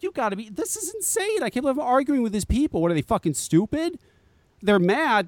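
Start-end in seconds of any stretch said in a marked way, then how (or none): 0.0-4.0 high-pitched, incredulous rant, voice shooting up; can't believe the people he's arguing with; exasperated disbelief. Retold or imitated. Arguing online with defenders of copycat packaging, high exasperated disbelief. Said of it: "I'm like".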